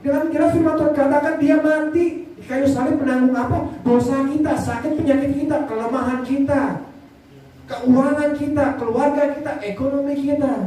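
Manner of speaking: high-pitched rhythmic sermon litany; fervent preaching. Real, not acted. Preacher listing burdens borne on the cross, fervent preaching. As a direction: drive each item home, pitched high. Preaches scripture in rising list.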